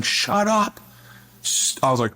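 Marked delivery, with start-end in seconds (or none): 0.0-0.8 high-pitched mock yell, no real volume; retold group yell. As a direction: imitate a crowd yelling someone down. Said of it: "on their feet just yelling".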